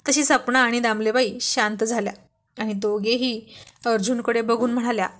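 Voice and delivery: ordinary delivery, nothing marked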